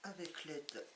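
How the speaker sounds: slightly hoarse, rough throat; talking through a hoarse throat. Real, not acted. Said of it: "j'ai la voix enrouée".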